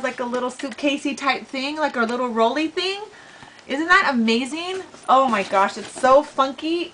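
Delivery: high, gushing delight; excited. Real, not acted. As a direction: rave about a funky find. Gushing over a thrift-store bag.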